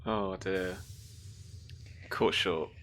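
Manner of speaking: ordinary talk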